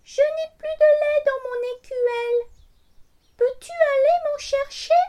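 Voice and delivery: high, loud little cat voice. A narrator's reading. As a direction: speak as a small pleading cat. Cat character speaks.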